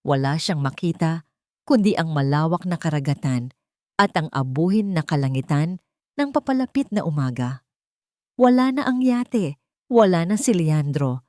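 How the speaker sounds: plain reading voice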